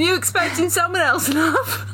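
high, laughing mock British line; British accent. Reader repeats the vampire's line to friends, laughing. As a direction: camp up the British rogue, giggling. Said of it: "I need to do British".

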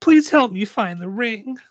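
put-on high, pleading child's voice; small high tearful-kid plea; near tears. A narrator's reading. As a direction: beg like a little girl about to cry. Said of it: "looks like she's going to cry".

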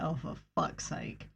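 very soft, low aside; muttered exasperation. Retold or imitated. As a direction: mutter it wearily under your breath. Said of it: "where I was like".

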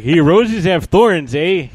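slow, loud hoser Canadian eh; Canadian accent. Retold or imitated.

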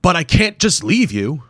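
high, loud pleading protest. Acted. Wimple protesting to the hero, high and loud. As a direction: refuse to go.

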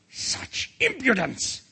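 high, drawn-out outrage; angry royal shout. A narrator's reading. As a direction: exclaim it slowly as an affronted king. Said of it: "shouted the king".

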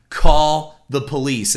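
loud, slow yell across the lobby; yelled order. Retold or imitated. Manager yelling to box office girls for police, loud slow yell. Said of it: "and I yelled".

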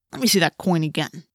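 understated wary demand; suspicious. A narrator's reading. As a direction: doubt the fairness of it. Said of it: "Marco said suspiciously".

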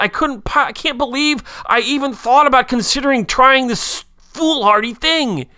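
high, lightly voiced despair; anguished inner voice. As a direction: voice a guilt-stricken inner cry. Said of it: "what you hear is".

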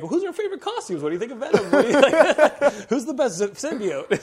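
high, loud, cracking up mid-quote; laughing. Real, not acted. Podcaster mimicking poor Scott's costume questions to his co-host, laughing. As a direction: struggle to get the questions out through laughter.

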